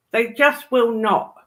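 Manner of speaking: ordinary talk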